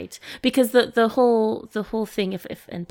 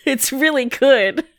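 laughing; 6 semitones higher, 8 dB louder, slower than usual. Real, not acted. Laughing reaction.

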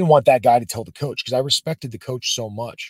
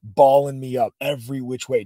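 ordinary delivery

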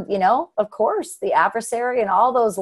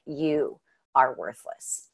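accusing inner voice; 7 semitones lower, 7 dB quieter, much slower than usual. Retold or imitated. The adversary whispers to her.

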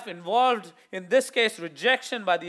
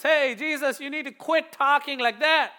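higher, insistent rebuke; scolding; 6 semitones higher, 4 dB louder than usual. Retold or imitated. Peter rebuking Jesus for his talk, scolding. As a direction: tell him off. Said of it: "He tells Jesus".

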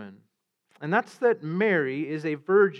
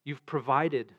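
soft, hushed pastoral prayer, mostly quieter; 4 semitones lower, 4 dB quieter than usual. Real, not acted. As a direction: pray humbly before the sermon.

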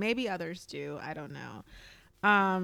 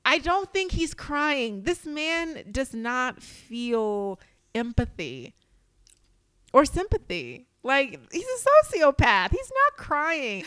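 slow, emphatic, high disbelief; indignant insistence; 4 semitones higher, 5 dB louder, slower than usual. Real, not acted. Scoffing at a castmate's crying, slow indignant insistence. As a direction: lean hard on every denial, slowly.

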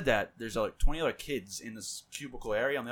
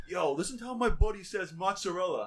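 higher-pitched, lightly imitated friend calling someone over; amazed Texan friend; 4 semitones higher than usual. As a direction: retell the friend marvelling at a word, pitched up. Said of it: "He calls his friend".

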